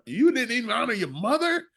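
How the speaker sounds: high, scandalised quote; mock outrage. Society shaming someone who forgot Mother's Day, mock outrage.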